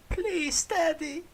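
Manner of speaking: very high whiny plea; whined. Acted. Son begging his father, in a very high whine. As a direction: beg in a squeaky high whine. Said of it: "I whined".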